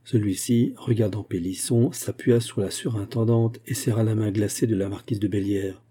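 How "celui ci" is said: ordinary delivery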